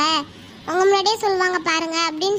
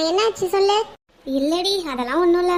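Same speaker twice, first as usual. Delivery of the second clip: usual speaking voice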